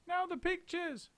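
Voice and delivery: very high, slow cry; very high monkey cry; eager cry. A narrator's reading. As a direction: next job at once. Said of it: "cried Mugglewump".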